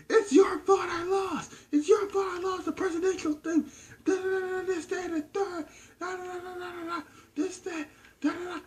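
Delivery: very high, wailing mock scream of a sore loser; mimicking a screaming woman. Storyteller mimics Samantha screaming at Nigel, high wailing mock scream. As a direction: shriek it like a crying, furious loser. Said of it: "she's just screaming".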